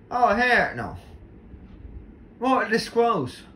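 high, loud frightened cries; scared outburst. Real, not acted. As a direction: panic as the monster gets close. Monster right next to player.

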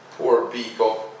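plain reading voice

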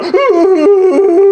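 loud, high burst of laughter; real loud high laughter. Real, not acted. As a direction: cackle out loud at it. Laughs at Paige crying.

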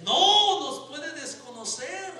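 high, drawn-out comic protest; alarmed cry. Retold or imitated. Passer-by objects during dog fight, comic alarmed cry. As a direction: burst out loud on the first word, then stretch it.

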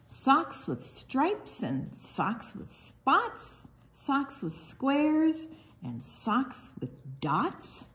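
sing-song picture book read; reading to children. Acted. Librarian reads duck book to children, sing-song. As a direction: bounce the rhymes for little listeners.

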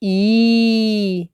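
one long, held "І"; drawn-out vowel. Shown as a demonstration. Teacher showing a vowel is drawn out, long "І". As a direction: sustain "І" evenly. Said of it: "Він вимовляється протяжно".

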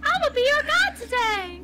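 high squeaky gingerbread-man voice; Timmy. Acted. Co-host mimicking spirit guide, high squeaky voice. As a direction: squeak it like a cartoon cookie. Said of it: "Timmy sounds like the gingerbread man from Shrek".

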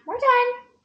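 high, firm sing-song; high sing-song we're done. Real, not acted. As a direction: wrap it up playfully. Telling child to stop.

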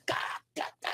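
high, drawn-out mock laugh "cá, cá, cá"; mimicked cackle. Storyteller mimics a woman laughing at the joke, high mock cackle. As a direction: imitate her guffaw in slow syllables.

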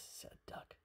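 usual speaking voice

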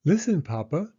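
put-on high, eager child voice; a small girl begging her mother to listen; little girl Penny. Little Penny asks Mama to listen, high and eager.

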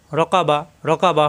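ordinary delivery

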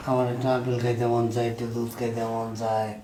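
faint, ailing self-pity; feeble pleading. Acted. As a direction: murmur it weakly from the sickbed. Sick father longs for food.

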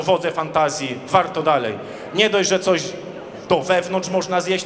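usual speaking voice

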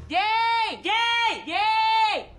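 three high bursts of yay; bright repeated cheer. Acted. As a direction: cheer like a delighted kid. Cheering a happy moment.

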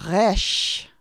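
Resh with a rolled throaty r from the back of the throat; guttural Resh. Shown as a demonstration. Teacher modelling guttural Resh for learners. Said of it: "You say it back in the beginning of your throat".

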